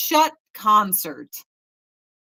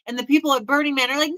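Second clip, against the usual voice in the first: ordinary delivery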